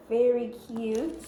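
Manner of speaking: usual speaking voice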